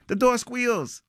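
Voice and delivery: high, squealing burst of alarm; sudden high-pitched story shock; startled outburst. Acted. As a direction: jump out of the narration in a high voice. Sudden jolt in suspense story.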